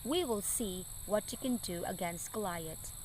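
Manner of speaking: gentle, soft storybook reading; story narration. A narrator's reading. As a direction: read it like a bedtime story for kids. Reading Bible story to children.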